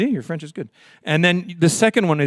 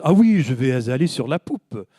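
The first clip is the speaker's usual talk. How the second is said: fancy French accent; 3 semitones lower than usual. Shown as a demonstration. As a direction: put on a grand, refined French accent. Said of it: "it sounds much l-, fancier in French".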